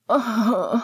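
high groan sliding down; pained groan. A narrator's reading. Lily in labour cut off mid-sentence, pained groan. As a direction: groan as pain cuts off the words. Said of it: "cut off by a groan".

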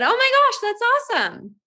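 very high, gushing, overly sweet reply; imitating her own forced cheerfulness; answering the stranger mom, gushing and very high; fake enthusiasm. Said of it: "And I said".

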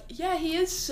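mock polite confusion; puzzled onlookers. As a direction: agree uncertainly, pitch lifted. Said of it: "people would look at me like".

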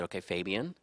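imitating a calm boss, soft and low; low, softened, unruffled retold reply. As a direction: answer quietly, taking the heat out. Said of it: "he was very calm about it".

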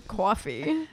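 coffee with a long open New Jersey aw vowel; coffee as "cawfee", New Jersey style. Shown as a demonstration. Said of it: "the negative is that I say dog".

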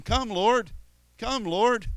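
preacher mimicking believers who keep calling for a Lord already present, high and pleading; mock pleading. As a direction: beg like someone ignoring the one already there. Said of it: "the first thirty minutes you say to him".

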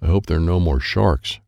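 ordinary delivery, nothing marked

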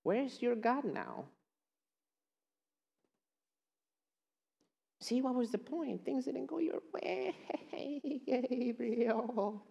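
mocking enemy voice with a sly laugh; the enemy's taunting voice. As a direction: gloat like a sly tempter. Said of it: "the enemy comes to you and says".

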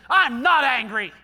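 loud, slow, clenched denial; mock angry denial. Person in denial, loud angry protest. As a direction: insist you're calm while fuming. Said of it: "the person that says".

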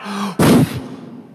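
sharp inhale, long forceful puff out; big breath blown out. Acted. Preacher acting Adam blowing off God, big blown breath. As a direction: suck in air and blow it away. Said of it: "blew God's directives off".

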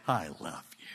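very low, hushed reply; tender whisper. Acted. God answering the broken man, tender whisper. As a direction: answer softly, almost all breath. God's quiet answer to protest.

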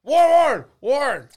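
high, loud repeated calling of a name; calling out repeatedly. Retold or imitated. Fan chasing Warren Sapp through the club, calling loudly. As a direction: chase him down with his name.

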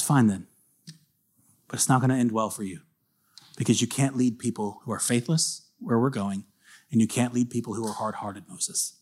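solemn God. Retold or imitated. God to Moses, soft solemn warning. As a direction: let it sink like a verdict.

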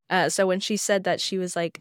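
ordinary talk

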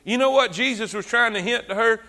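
ordinary talk

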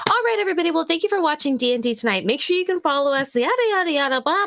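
upbeat stream outro host; customer service voice. Retold or imitated. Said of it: "The customer service voice".

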